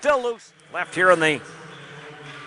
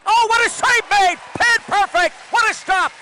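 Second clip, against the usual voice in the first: excited save call; 8 semitones higher than usual. Real, not acted. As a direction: voice jumps high, pouring out the praise. Spectacular save, commentator erupts.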